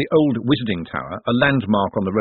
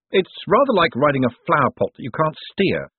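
higher voice pitched over noise; mild yell; 6 semitones higher than usual. A narrator's reading. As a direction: lightly yell to be heard over the din. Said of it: "Moist yelled to make himself heard".

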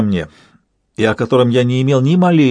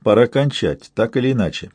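usual speaking voice

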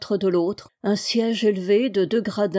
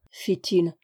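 plain reading voice

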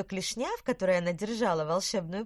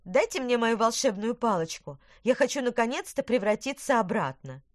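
louder, higher firm demand; 5 semitones higher, 6 dB louder than usual. A narrator's reading. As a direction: insist on getting the wand. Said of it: "потребовала она".

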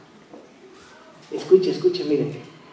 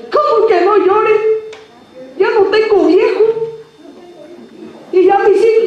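indignant widow; 9 semitones higher than usual. Acted. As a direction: fire it back at Jesus, outraged and high. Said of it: "le ha de haber dicho".

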